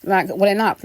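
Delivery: emotional. Real, not acted. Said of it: "I'm getting a little bit emotional".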